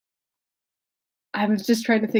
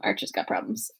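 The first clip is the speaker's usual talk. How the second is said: normal talking voice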